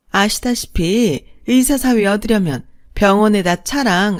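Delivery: high, loud, mocking sneer of a snobbish stepmother-in-law; scornful rich woman belittling the bride's family; sneering second mother. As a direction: look down your nose and jeer at the poor in-laws.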